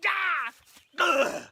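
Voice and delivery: loud, high yell, then a groan; hammed-up cry of mortal pain; stabbed yell. Actor plays Tybalt stabbed, hammed-up yell.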